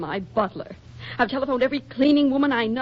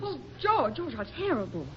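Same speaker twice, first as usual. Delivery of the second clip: shocked; 3 semitones higher, 9 dB quieter than usual. Acted. Hearing of the plane crash.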